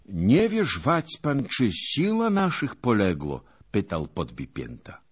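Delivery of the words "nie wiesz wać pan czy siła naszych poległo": raised, louder earnest voice; a simple knight eager to know; earnest knight asking. A narrator's reading. Podbipięta asking Zagłoba about the fallen, earnest and raised.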